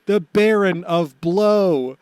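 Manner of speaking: loud, slow grand introduction; hype announcer. Acted. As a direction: announce him like a ringmaster. Announces Buggy Watch.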